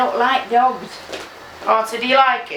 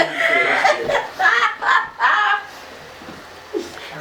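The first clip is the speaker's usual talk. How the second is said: high laugh; 13 semitones higher, 6 dB quieter than usual. Real, not acted. Grandma laughing after goodbye.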